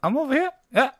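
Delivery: very high, loud little gnome. Acted. Overlooked gnome calling out to the bar, high-pitched. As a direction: pipe up high as a tiny gnome. Gnome player answers in character.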